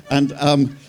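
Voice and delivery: normal talking voice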